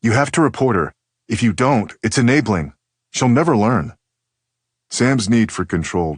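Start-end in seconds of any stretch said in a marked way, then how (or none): 0.0-4.0 higher, louder adamant daughter; insistent. Retold or imitated. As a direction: insist hard on it. Daughter insists, voiced loudly.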